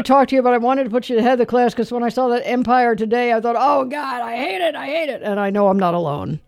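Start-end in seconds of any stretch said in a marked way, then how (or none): 3.6-5.2 mock anguish at something you can't stand; recalled dismay, pitched up; exasperated loathing. Recalls own reaction to the commercial.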